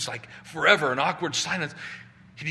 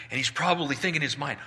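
ordinary delivery

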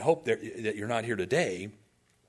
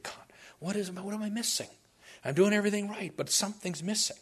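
high-pitched, puzzled praying; searching plea; 7 semitones higher, faster than usual. Acted. Cornelius asking God what he is missing, searching plea. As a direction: plead with God, higher and earnest.

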